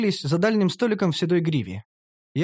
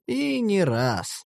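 slow tease; 4 semitones higher, much slower than usual. Acted. Master teases the elf he will try more than once, very slow. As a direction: drag it out slyly. Hero teasing.

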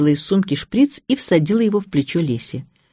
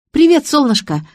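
high, louder phone hello; cheery greeting; 10 semitones higher, 5 dB louder than usual. A narrator's reading. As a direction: greet a darling brightly from a high pitch.

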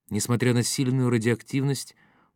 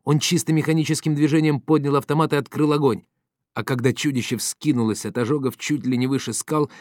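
usual speaking voice

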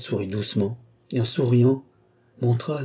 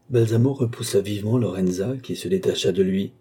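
usual speaking voice

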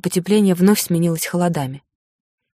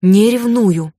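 stubborn denial, a bit louder; 3 semitones higher, 4 dB louder, much slower than usual. A narrator's reading. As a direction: dig in and deny it. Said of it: "я упрямо повторила".

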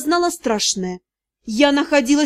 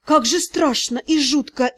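usual speaking voice

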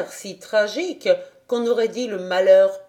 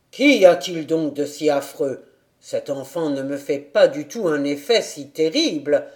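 low father voice; 4 semitones lower, 3 dB louder than usual. A narrator's reading.

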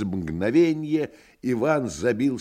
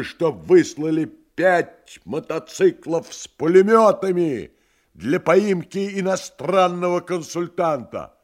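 high, loud, urgent shouting; shouting on the phone; 10 semitones higher, 7 dB louder than usual. Acted. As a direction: bark orders down the line. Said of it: "закричал Иван в трубку".

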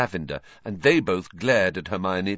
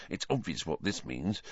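much softer Ron whisper; 10 dB quieter than usual. A narrator's reading. Ron whispering a joke to Harry and Hermione. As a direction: hush it to your friends. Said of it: "Ron whispered".

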